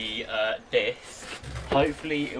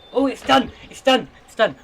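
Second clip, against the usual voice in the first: excited; 10 semitones higher, 12 dB louder than usual. Real, not acted. Excited about finished load.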